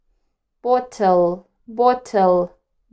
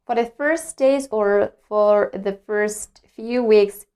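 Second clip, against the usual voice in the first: ordinary talk, nothing marked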